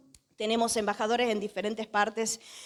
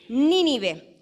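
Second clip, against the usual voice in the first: Nínive with the first syllable punched louder; stress on first syllable; 5 dB louder than usual. Shown as a demonstration. Preacher teaching the congregation to say Nínive, first syllable stressed. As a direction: lean hard on the opening "Ní" of Nínive. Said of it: "con acento en la primera E".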